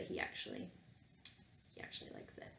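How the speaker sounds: hushed confiding aside; whispered aside. Real, not acted. Telling viewers a secret about Bernard, whispered.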